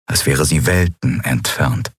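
ordinary delivery

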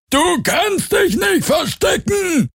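very high, slow, stretched shout; distant menacing call. A narrator's reading. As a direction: shriek it out, drawing each word. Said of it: "irgendwo der Ruf ertönte". (gedehnter, sehr hoher Drohruf)

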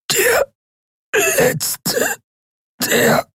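very high, strangled rattle; rattling choke. A narrator's reading. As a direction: fade into a choking rattle. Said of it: "unverständlichen Röcheln".

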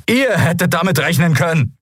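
high-pitched reproach; angry accusation. A narrator's reading. Bearded man accuses the guards, angrily. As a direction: press the blame on them.

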